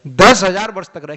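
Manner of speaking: booming, emphatic shout on दस; preaching at full force; loud proclamation. Real, not acted. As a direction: thunder out दस हजार वर्ष with a falling close. Preacher proclaims ten thousand years.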